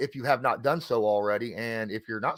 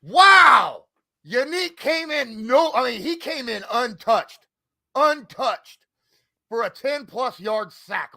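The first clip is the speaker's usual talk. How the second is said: high, slow excited raving; 11 semitones higher, 7 dB louder, slower than usual. Real, not acted. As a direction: gush about the big play. Raving about the sack.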